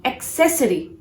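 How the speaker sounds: "accessory" as ak-SESS-uh-ree; "accessory" stressed on SESS; correct accessory. Shown as a demonstration. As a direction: keep the K before S in "accessory". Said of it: "to be pronounced as accessory".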